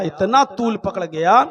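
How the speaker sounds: ordinary delivery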